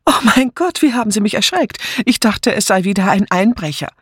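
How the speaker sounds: high-pitched, frightened exclamation; startled cry. A narrator's reading. Startled woman mistaking the sergeant for a burglar, crying out. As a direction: start high with fright, then settle slightly. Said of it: "Sie stieß einen schwachen Schrei aus".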